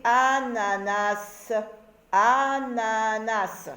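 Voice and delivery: "ananas" very slow, each syllable stretched. Shown as a demonstration. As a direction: read "ananas" back in long, linked syllables. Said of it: "la troisième et dernière syllabe".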